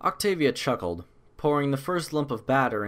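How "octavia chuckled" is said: ordinary delivery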